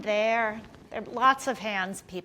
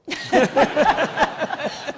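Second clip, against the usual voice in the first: loud, high burst of real laughter; hearty laugh; 7 semitones higher, 9 dB louder than usual. Real, not acted. Host cracking up at the guest's flat no, laughing loud. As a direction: laugh out loud at the one-word answer.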